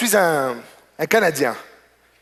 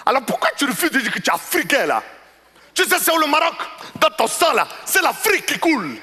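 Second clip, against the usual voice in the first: pitched up, lecturing the clerk; higher and insistent, wagging a finger at him; indignant African customer; 8 semitones higher than usual. Retold or imitated. Customer insisting to the Moroccan clerk that he is African, pitched up and lecturing.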